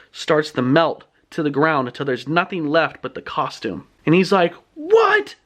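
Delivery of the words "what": very high, shocked yelp; acted high-pitched disbelief; freaking out. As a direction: imitate a boy floored by what he sees. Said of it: "Skipper's freaking out".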